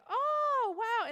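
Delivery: very high, loud, drawn-out wonder; awestruck kids. Retold or imitated. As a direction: imitate a group of kids marvelling. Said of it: "all these kids are like".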